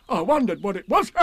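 high, bouncy tiger voice; Tigger. A narrator's reading. Tigger being unwound from the tablecloth, high and bouncy. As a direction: pipe up as an excitable tiger. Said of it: "said Tigger".

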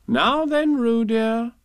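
put-on high, loud sing-out to a child; motherly call. A narrator's reading. Kanga taking her baby from the pocket, motherly sing-out. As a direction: sing it out to a little one.

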